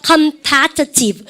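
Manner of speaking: very slow, syllable by syllable; slow vocabulary model; slow model word. Shown as a demonstration. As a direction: stretch the word out for the class. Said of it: "형용사 뭐가 있다고?".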